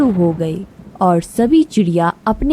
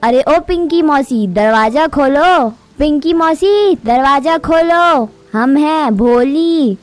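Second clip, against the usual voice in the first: calling out; 6 semitones higher than usual. Acted. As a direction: shout to Aunt Pinki over the rain. Knocking, calling aunt in rain.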